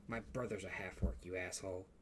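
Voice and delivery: low, very soft grumble; quietly offended. Acted. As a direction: keep the insult low and under your breath. Offended partner answers in a low voice.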